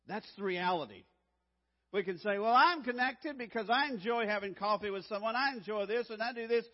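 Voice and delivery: loud, building sermon drive; full-force preaching to the congregation; rising preaching. Acted. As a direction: build the fire back up.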